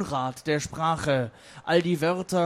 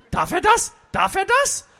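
high, loud squawking question; Kristall; 11 semitones higher, 8 dB louder than usual. Acted. Heckler calls up from under the manhole, squawking. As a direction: squawk it twice, the second even higher. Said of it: "hörte man von unten Kristall".

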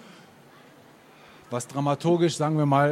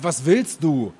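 restrained narrated shout; mild storyteller's yell; shouted question; 4 semitones higher, 6 dB louder, slower than usual. A narrator's reading. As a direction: challenge with a slightly raised voice. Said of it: "Er schreit".

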